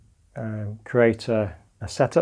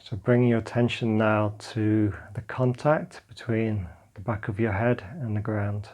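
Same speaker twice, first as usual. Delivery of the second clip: calm meditation guidance, drifting softer; guided meditation; 3 dB quieter than usual. Acted. Teacher guiding listeners lying on the floor through a body scan, calm meditation voice. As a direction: guide them gently into letting go.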